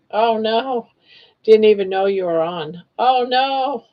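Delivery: ordinary delivery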